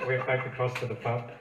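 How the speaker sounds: ordinary talk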